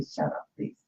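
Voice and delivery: whispered shut up, please; breathy whisper of a plea; hushed quote. Retold or imitated. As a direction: hush it like friends begging her to stop. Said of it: "people had to tell me".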